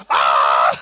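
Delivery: loud acted scream of fright; terrified scream. Father spots daughter on the roof, terrified re-enacted scream. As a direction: scream as if scared for your life. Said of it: "Jag vart ju livrädd".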